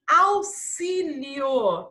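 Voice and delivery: "auxílio" slow, in syllables, with a long s. Shown as a demonstration. Teacher showing pupils the double S sound, "auxílio" slowly sounded out. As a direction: stretch out "auxílio" as au-si-lio. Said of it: "Tem som de dois S's".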